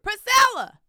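loud, high shout across the room; husband's yell. Retold or imitated. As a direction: call her name across the red carpet. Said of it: "And he yells".

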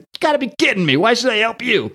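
a hint of a tough brawler, put-on voice; gruff Thing voice. The Thing snarling at the Puppet Master in a mildly put-on tough voice. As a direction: lightly voice an angry rocky hero.

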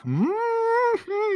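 high-pitched swooning "mmm"; long, high, lustful mmm; appreciative hum. Real, not acted. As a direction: hum in drawn-out admiration, high up. Swooning over Geralt's looks.

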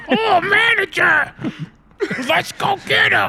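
very high, loud manic shouting; frantic fry cook NPC. Acted. Fryer guy yelling at the kitchen in a high manic voice. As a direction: scream it like an unhinged fryer guy. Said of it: "I think you're excited".

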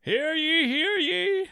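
high, slow herald proclamation; court herald. Pretend. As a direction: proclaim it like a town crier. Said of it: "Get a court herald".

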